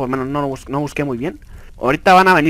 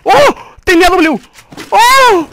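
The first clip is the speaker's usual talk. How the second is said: high, loud shouts of amazement; thrilled, high-pitched whooping cries; ecstatic disbelief; 12 semitones higher, 7 dB louder than usual. Real, not acted. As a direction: shout it high, utterly blown away. Celebrates a surprise win.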